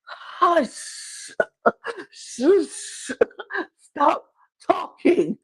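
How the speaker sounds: high, strained mock scream, breaking into hushing; mock inner scream. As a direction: act out a desperate scream held inside. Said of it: "He was screaming".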